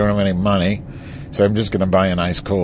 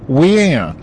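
high, drawn-out "wah"; mocking wail; mock Republican whine; 4 semitones higher than usual. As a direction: whine it like a caricatured complainer. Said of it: "and they're saying".

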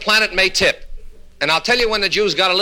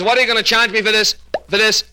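high-pitched stammer on "for this"; stuttering patient; 6 semitones higher than usual. Acted. Patient asks the doctor's fee, stuttering. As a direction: repeat the phrase until it comes out. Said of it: "a little impediment in his speech".

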